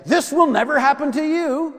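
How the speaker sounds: high, loud outburst of protest; Peter. Acted. Peter protesting Christ's prediction of the cross, loud and vehement. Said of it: "what does Peter say?".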